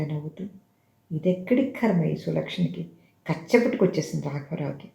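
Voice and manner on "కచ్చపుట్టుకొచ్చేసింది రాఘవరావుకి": plain reading voice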